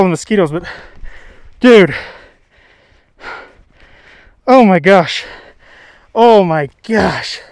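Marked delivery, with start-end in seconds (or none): 1.6-7.4 loud, overwhelmed excitement; freaking out. Real, not acted. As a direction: marvel loudly at a huge catch. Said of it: "I'm freaking out".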